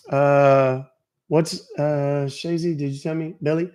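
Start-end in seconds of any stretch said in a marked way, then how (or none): none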